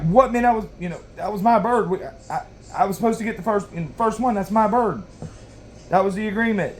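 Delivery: retold angry protest. Son protesting to laughing dad over the stolen turkey, mock outrage. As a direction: sound outraged that your prize was taken. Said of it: "I'm pissed".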